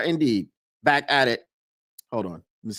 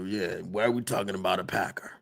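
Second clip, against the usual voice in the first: ordinary talk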